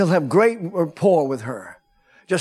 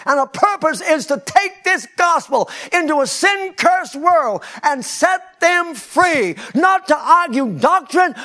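preaching at full force; 11 semitones higher, 5 dB louder than usual. Real, not acted. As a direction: proclaim it to the rafters.

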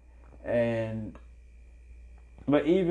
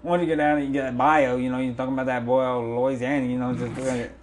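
put-on Louisiana bayou accent; 3 semitones higher, 4 dB louder than usual. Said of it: "with the accent".

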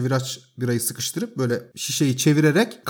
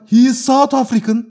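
mock defiant retort; 8 semitones higher, 5 dB louder than usual. Retorting to the angry landlord.

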